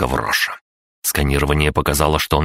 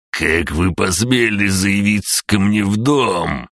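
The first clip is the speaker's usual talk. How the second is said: put-on high, slow, outraged old man yell; 5 semitones higher, slower than usual. A narrator's reading. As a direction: howl it like an old crook. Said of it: "завопил он". (вопящий старик)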